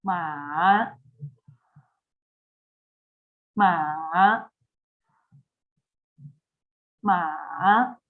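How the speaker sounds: '马' dips low, then rises again; third tone demo. Shown as a demonstration. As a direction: give '马' the dipping third tone slowly and clearly. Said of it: "One more".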